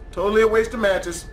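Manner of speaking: usual speaking voice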